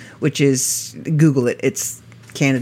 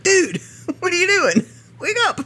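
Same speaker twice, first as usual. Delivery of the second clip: mock alarmed cry, much higher, laughing in it; alarmed wake-up yell. Narrator retelling yelling at a sleeping driver, mock alarm. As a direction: retell jolting the sleeping driver, pitch shooting up. Waking a friend asleep at wheel.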